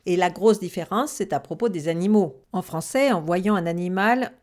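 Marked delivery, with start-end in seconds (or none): none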